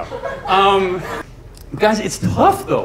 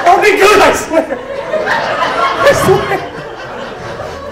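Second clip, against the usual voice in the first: very high, squeaky promise; mock pleading; 6 dB louder, much slower than usual. Comic promising the crowd he'll behave, high mock pleading.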